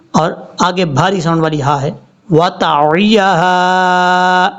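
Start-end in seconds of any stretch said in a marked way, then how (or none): 2.3-4.5 وَتَعِيَهَا with a distinct ain and ya; slow, careful tajweed; heavy ha at the end; clear ain and ya. Shown as a demonstration. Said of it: "عین کو clear کرنا ہے".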